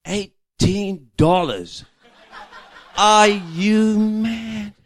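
high, loud, slow mock outrage at the price; hammed-up shock at the bill; outraged husband. Husband balks at the ice cream price, loud mock outrage. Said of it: "he goes into this argument".

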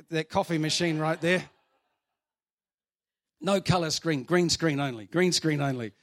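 loud, ringing sermon voice; full-force preaching. Acted. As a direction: preach it out to the whole room. Preaching to the congregation.

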